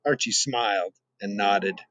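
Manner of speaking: plain reading voice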